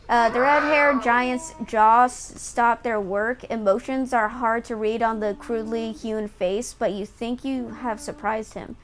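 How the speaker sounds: normal talking voice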